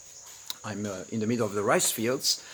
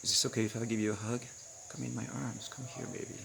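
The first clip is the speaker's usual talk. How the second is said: tender invitation; 7 dB quieter, faster than usual. Retold or imitated. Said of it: "And then you go like".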